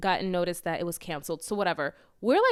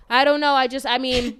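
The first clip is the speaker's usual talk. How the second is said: louder, clueless shrug of a driver; imitating the driver; 3 semitones higher, 8 dB louder than usual. Uber driver shrugging at the panicked passenger, loud and clueless. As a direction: sound helpless and unsure.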